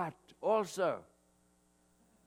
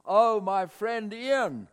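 glad greeting; 7 semitones higher, 7 dB louder, slower than usual. Retold or imitated. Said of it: "And his response was".

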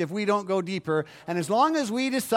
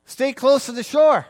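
put-on a touch louder, like a parent calling to a child; mock the parents' warning; lightly voiced parental caution; 6 semitones higher, 7 dB louder than usual. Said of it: "my mom and dad said".

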